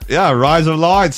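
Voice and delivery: drawn-out Aussie vowels; broad mock-Australian blend; fake Australian accent. Shown as a demonstration. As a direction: echo it in Australian. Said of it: "in an Australian accent".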